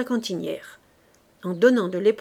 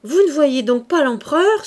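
loud, higher urgent cry; shouted rebuke; 5 semitones higher, 9 dB louder than usual. Acted. Sergeant shouting at his men to see the emperor, loud. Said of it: "cria à ses hommes".

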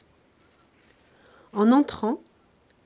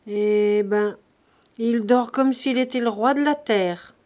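ordinary delivery, nothing marked

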